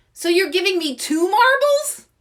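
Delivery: high loud delighted question; excited. Acted. Louise thrilled at getting two marbles, high loud delight. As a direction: burst out with glee.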